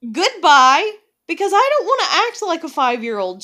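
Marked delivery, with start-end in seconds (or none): 0.1-0.9 dismissive goodbye. Retold or imitated. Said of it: "that's usually when I'm like".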